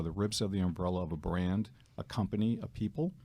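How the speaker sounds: usual speaking voice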